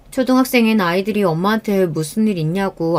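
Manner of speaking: ordinary delivery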